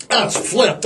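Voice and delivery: usual speaking voice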